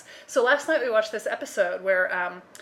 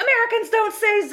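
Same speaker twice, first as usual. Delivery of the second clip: indignant outburst; 14 semitones higher, 8 dB louder than usual. Retold or imitated. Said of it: "And I was like".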